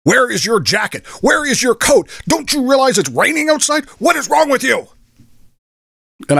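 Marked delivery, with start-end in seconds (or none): 0.0-4.9 mock angry yelling, pitched much higher; imitating a yell. Crazy guy yelling at the narrator for having no coat, high mock yell. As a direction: ham up the crazy guy's rant in a high voice. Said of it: "starts yelling at me".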